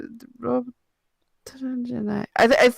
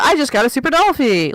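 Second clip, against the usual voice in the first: raised pitch, playful announcement; mildly excited new owner; 7 semitones higher than usual. Acted. Guessing the caption's energy, mildly excited. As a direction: lightly act out the excitement of a new purchase. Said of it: "like, that kind of energy".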